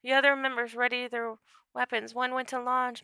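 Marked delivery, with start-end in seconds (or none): none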